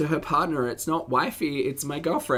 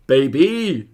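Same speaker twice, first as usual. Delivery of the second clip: imitating Chels; 7 dB louder than usual. Reads her message and imitates her cooing baby. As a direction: mimic how she calls out baby. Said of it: "How does he, she say it, Chels?".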